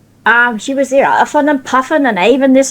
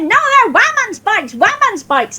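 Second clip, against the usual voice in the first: shouting his head off; 10 semitones higher than usual. Retold or imitated. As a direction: yell it over and over. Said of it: "he was shouting his head off".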